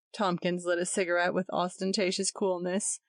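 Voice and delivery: usual speaking voice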